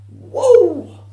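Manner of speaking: loud, high, long woo; piercingly loud excited holler; manic whoop. Acted. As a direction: let out a huge high whoop. Second whoop amid rant.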